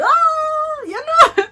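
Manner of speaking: high, loud mock shout; mimicking Jamaican crowds, loud mock shout. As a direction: burst out like a pushing crowd.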